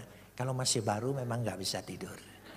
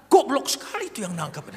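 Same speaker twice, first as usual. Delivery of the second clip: higher and louder scorn; scornful; 11 semitones higher, 9 dB louder than usual. Retold or imitated. Cellmate scorning the arresting officers, loud. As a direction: spit out the insult.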